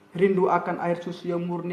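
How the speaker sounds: ordinary talk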